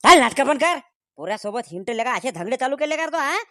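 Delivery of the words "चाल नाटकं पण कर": loud, sharp scold at the son; angry scolding. Acted. Father scolding his son for roaming with a girl, angry. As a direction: bark it like a fed-up father.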